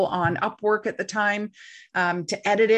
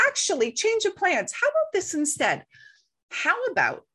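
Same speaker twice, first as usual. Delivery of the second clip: high, bright salesy improvising voice; breezy pitch; 10 semitones higher than usual. Retold or imitated. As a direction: sell the new plan as if it was always the plan. Improvising a new deal on phone.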